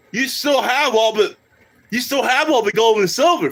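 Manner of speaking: normal talking voice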